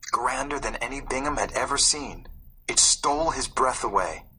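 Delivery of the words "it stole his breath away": ordinary delivery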